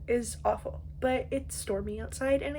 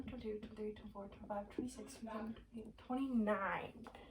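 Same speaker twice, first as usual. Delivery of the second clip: quiet counting; 9 dB quieter than usual. Real, not acted.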